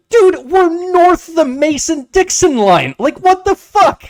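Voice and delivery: high, loud disbelieving outburst; exasperated. Retold or imitated. Shopper baffled by the cashier's glare, high loud outburst. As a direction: squeal it in disbelief. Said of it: "And I'm like".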